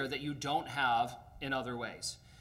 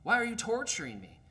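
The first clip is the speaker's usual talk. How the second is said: much higher, anguished protest; distressed ghost; 6 semitones higher than usual. Acted. Ghost protests that the angel is torturing him. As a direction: cry out as if tortured.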